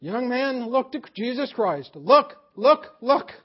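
a revival preacher shouting the command at the stranger; preacher's shout. Retold or imitated. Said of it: "he shouted".

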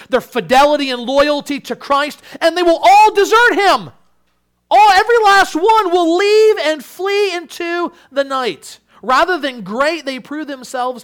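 very loud, high-pitched sermon climax; full-force preaching. Real, not acted. Preacher declaring the disciples will desert Christ, full-force preaching. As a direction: cry it out to the whole congregation.

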